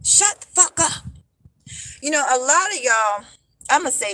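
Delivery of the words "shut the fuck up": mock angry man. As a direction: imitate the rude commenter again, higher. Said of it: "here he goes".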